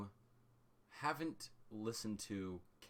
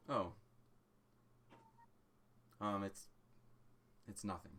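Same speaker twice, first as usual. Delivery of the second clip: dismissive, fading softer; trailing off; 4 dB quieter than usual. Acted. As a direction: brush it off and trail away. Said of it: "and you hear".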